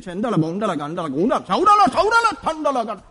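babbling parody of praying in tongues; hammed-up burst of tongues; mock tongues-speaker. Mock five minutes of tongues.

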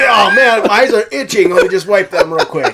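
high, loud fake-innocent cover-up; mock casual excuse. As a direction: act like it's just itchy eyes.

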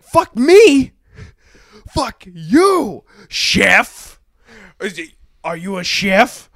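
high, loud indignant berating; mock outraged tirade. TV host to defiant chef, retold outraged yelling. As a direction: imitate a boss blowing up at his chef.

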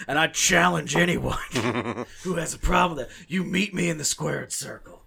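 loud, high wrestling-promo challenge; big blustering promoter voice; Vince McMahon. Acted. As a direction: drag it out like a wrestling boss calling someone out. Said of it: "turn into Vince McMahon with that voice".

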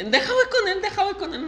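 high falsetto mimic of the woman, laughing; mock woman's voice. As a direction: lift the pitch way up and play the girl begging to go. Voicing the woman in story.

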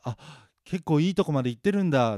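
a loud, high slip of the tongue; loud, carefree blurt about the game; blurted excitement. Retold or imitated. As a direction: blurt it out before thinking. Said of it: "口滑ってつい言っちゃった".

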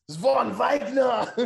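slow, high, loud name through a laugh; laughing announcement. Real, not acted.

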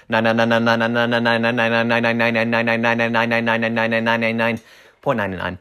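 machine-gun the nines like an ad; rapid repeated nines. Acted. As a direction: rattle off the nines fast and sing-song. Rapid price gag.

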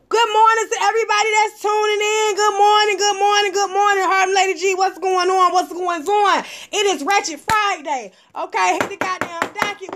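high, upbeat host hello; show host greeting. Acted. Host opening Ratchet Friday for listeners, upbeat.

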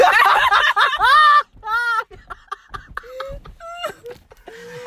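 high, loud peals of laughter; hysterical laughter. Real, not acted. Friends agreeing they are totally fucked, hysterical laughter. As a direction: collapse into a long fit of real laughter. Cracking up at the name Roberta.

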